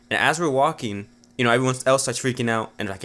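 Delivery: ordinary talk, nothing marked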